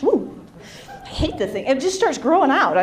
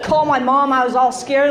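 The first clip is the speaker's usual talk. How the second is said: ordinary delivery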